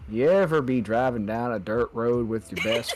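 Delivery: low southern truck-commercial narrator; deep southern voice. Pretend. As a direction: narrate a Ford ad in a put-on Texan accent. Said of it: "doing this deep southern voice".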